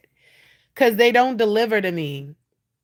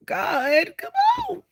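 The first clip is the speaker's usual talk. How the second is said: exasperated cry. Real, not acted. Exasperated appeal to God.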